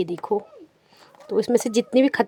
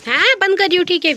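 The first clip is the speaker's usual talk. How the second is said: talking to the cat; 9 semitones higher, 7 dB louder than usual. Real, not acted. Answering the cat.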